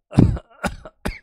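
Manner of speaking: loud hacking fit, unvoiced; real cough. Real, not acted. Teacher who came to class despite a cough, coughing loudly. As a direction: cough repeatedly and hard. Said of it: "मुझे खांसी भी चल रही है".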